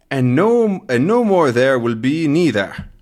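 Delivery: put-on Scottish accent. Reading Scottish comic dialogue aloud.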